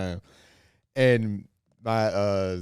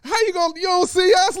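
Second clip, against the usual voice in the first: much higher, louder mock outrage; indignant disbelief; 9 dB louder than usual. Teens turned away at the door, high mock outrage. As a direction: protest being ignored. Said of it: "It's like".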